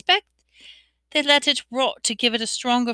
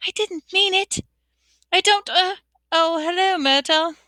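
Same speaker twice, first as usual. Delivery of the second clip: high, flustered backpedalling; frantic; 9 semitones higher than usual. A narrator's reading. Hermione caught out by the arriving ghost, frantic. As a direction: backtrack in a high, panicky voice. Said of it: "Hermione whispered frantically".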